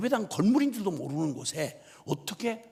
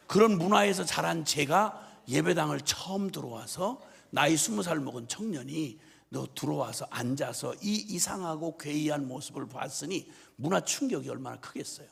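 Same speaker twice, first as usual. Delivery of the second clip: ordinary delivery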